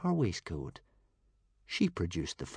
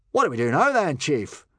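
worried henchman; 7 semitones higher, 8 dB louder than usual. A narrator's reading. Worried Viking asks the chief.

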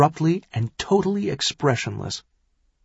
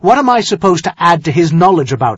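loud, heated, raised question; harsh retort; 6 semitones higher, 8 dB louder, faster than usual. Acted. Son-in-law disputes what he could add, harsh and loud. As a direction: argue back harshly, voice high and loud. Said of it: "Tyrion asked harshly".

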